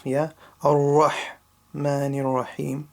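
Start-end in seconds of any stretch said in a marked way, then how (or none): 0.6-1.1 "Ar-Rah" with a heavy, full-mouthed ra and throaty ha. Shown as a demonstration. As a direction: round the mouth fully on the ra of "Ar-Rah". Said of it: "this ra has to be a full mouth".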